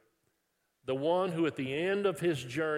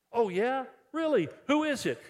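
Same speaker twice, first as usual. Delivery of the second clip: mock surprised reply; 9 semitones higher, 4 dB louder than usual. Acted friend's eager reply.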